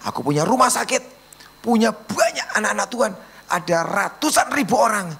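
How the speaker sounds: high-pitched, driving sermon testimony; impassioned preaching. Acted.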